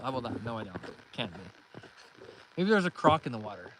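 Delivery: ordinary delivery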